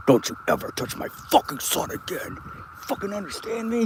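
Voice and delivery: dad warning the man who grabbed his son, hushed and menacing; hushed threat. Retold or imitated. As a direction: threaten him under your breath.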